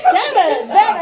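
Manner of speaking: laughing. Real, not acted. Laughing mock disappointment.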